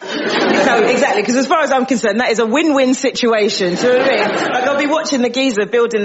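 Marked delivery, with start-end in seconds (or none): none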